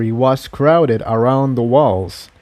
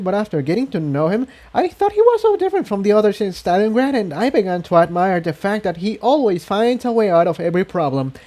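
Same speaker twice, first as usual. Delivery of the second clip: higher, fast gushing words; rushed confession; 7 semitones higher, faster than usual. A narrator's reading. Incendia confessing her crush, rushing and gushing. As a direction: rush it out, getting carried away. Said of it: "she stopped herself".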